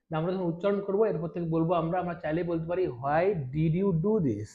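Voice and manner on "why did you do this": Why did you do this? said very slowly, every word apart; slow full form. Shown as a demonstration. Teacher shows the slow full form first. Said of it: "আমরা এভাবে বলতে পারি".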